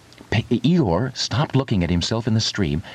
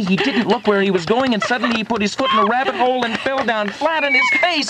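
narration climbing steadily higher to a breathless rush; building excitement; 10 semitones higher than usual. A narrator's reading. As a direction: race upward in pitch towards the disaster. Narration races toward Piglet's fall.

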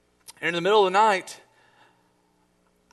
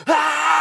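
high, loud, sustained scream; re-enacted nightmare scream; 12 semitones higher, 8 dB louder than usual. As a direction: scream long and high in terror. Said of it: "she hears me screaming".

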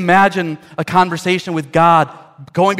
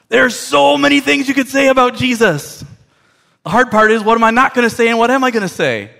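high, louder, animated protest; overwhelmed, in a high raised voice; exasperated outburst; 8 semitones higher, 4 dB louder than usual. Acted. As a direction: pitch it right up, worked up over the dilemma. Acts out the preacher's dilemma.

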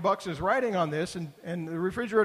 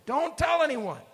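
much higher and louder, forceful warning; aggressive; 11 semitones higher, 5 dB louder than usual. Retold or imitated. Jesus warning the disciples, aggressive and forceful. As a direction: snap the order out like a threat. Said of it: "he says it rather aggressively".

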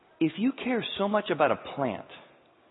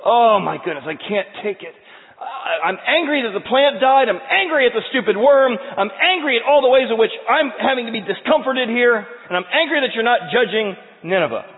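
shouted, much higher and louder; furious Jonah; 9 semitones higher, 9 dB louder than usual. Acted. Preacher acting Jonah's furious rant over the dead plant, full shout.

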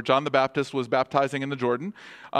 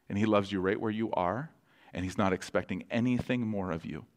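ordinary talk, nothing marked

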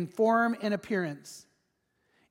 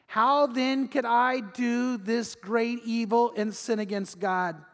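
each word drawn out slowly, weighty; 3 semitones higher, slower than usual. Shown as a demonstration. Preacher underlining Joseph's reply, slow and weighty. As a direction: lean on every word, slow. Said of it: "this is worth underlining".

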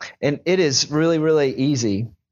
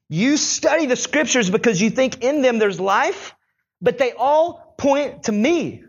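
raised, ending slow and deliberate on "they all point to me"; emphatic scripture quote; 6 semitones higher, slower than usual. Acted. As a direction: drive home the quote slowly. Preacher quoting Jesus emphatically.

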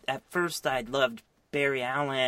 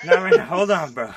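much higher, louder, laughing mock girl voice; mock Jesse; 7 semitones higher, 12 dB louder than usual. Playing Jesse to a friend, loud high giggly brush-off. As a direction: squeal it out through a laugh. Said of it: "And she's like".

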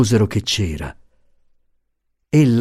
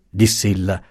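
ordinary delivery

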